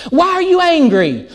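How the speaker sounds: high-pitched, pressing question in a quarrel; mock accusing question. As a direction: press a partner for an explanation. Acted couple's quarrel.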